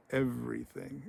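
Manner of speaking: soft, deliberate, haunting answer; menacing confirmation. Retold or imitated. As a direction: confirm it quietly and ominously. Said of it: "has this wonderfully deep voice".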